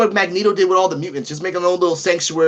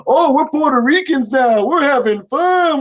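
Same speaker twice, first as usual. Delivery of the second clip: higher, slower smug tourist voice; mocking American newcomers; 9 semitones higher, 3 dB louder, slower than usual. Mocking Americans buying up land, higher slower smug voice. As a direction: parody clueless American buyers, drawn out. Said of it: "all these Americans coming in saying".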